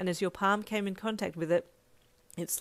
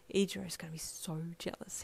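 soft conspiratorial whisper; 6 dB quieter than usual. A narrator's reading. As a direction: whisper it with glee. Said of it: "he whispered to you".

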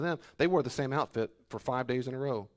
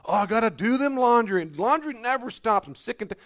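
higher, louder whining; mock whining complainer; 9 semitones higher, 7 dB louder than usual. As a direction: whine about laundry like a weary homemaker. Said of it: "complain that you've gotta".

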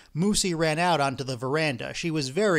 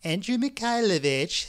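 higher-pitched scolding wife; woman's voice; 6 semitones higher than usual. A narrator's reading. Wife answering her husband from inside, higher woman's voice. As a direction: call back as a nagged wife. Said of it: "said a woman's voice from inside".

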